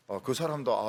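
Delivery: ordinary talk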